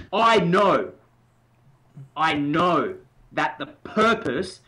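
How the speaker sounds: "know" punched loud and held; stress on know. Shown as a demonstration. Coach demonstrating emphasis, "know" said loud and slow. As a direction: hit "know" hard, then slow down. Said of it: "and emphasize that".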